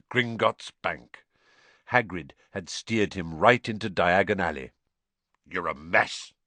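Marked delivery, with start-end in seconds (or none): 5.5-6.3 lightly gruff giant; low-key gruff scolding. A narrator's reading. Hagrid scolds sooty Harry, gruff. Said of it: "said Hagrid gruffly".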